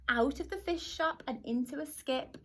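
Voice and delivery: plain reading voice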